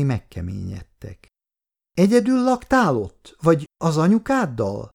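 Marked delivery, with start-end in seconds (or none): 1.9-4.9 put-on high-pitched, inquisitive child; little girl. A narrator's reading. Little girl asks about his mother, high child's voice. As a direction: ask high, like a small girl.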